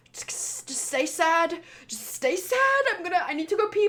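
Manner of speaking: high-pitched pretend pep talk to stay sad; mock self-coaxing. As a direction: urge yourself on in a mock strained, rising voice. Mocks forcing herself to cry.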